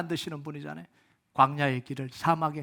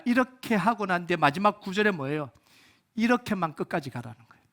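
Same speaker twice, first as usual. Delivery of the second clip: usual speaking voice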